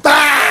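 high, loud shocked shriek; mom's shriek of alarm. A narrator's reading. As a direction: shriek in horror. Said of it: "the mom went".

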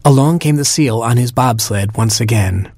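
usual speaking voice

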